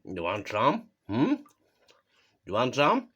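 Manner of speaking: normal talking voice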